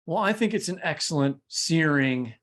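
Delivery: laughing over-the-top praise; playful hype; mock blurb gushing. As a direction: gush like a jacket quote. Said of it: "There's my blurb".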